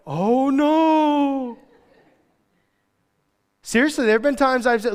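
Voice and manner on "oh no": high sarcastic oh no; mock fake alarm. God mocking the preacher's threat, sarcastic. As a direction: pretend to be worried, sarcastically. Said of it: "and he's like".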